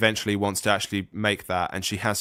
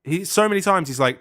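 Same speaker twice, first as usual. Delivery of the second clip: exasperated; 9 semitones higher, 6 dB louder than usual. Real, not acted. Exasperated retelling.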